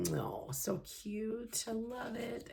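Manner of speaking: soft, drawn-out coo over a cute sticker. Real, not acted. Admiring a sticker.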